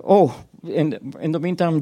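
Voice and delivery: ordinary delivery, nothing marked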